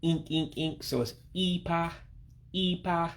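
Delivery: ink repeated high, then ipa with that ee; high-pitched I. Shown as a demonstration. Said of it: "real high-pitched I-like sound".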